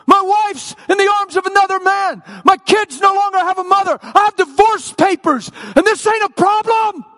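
furious high screamed list of grievances; acted rage at full volume, very high; angry yelling at God. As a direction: shout each grievance in outrage. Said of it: "like I yelled at God".

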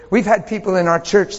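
normal talking voice

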